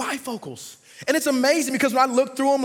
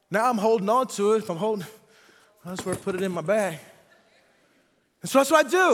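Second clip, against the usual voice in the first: normal talking voice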